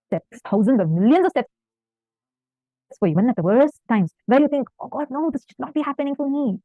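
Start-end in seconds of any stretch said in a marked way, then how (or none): 4.8-6.6 higher, softer imagined lament; mock dismay. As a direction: act out a devotee quietly fretting. Imagined reaction at worst times.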